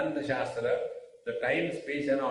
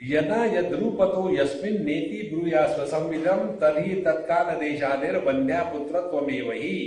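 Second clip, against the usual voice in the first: very fast, rhythmic scriptural recital; Sanskrit verse recitation; 2 semitones higher, much faster than usual. Acted. Teacher reciting the next verse, rapid rhythmic recitation.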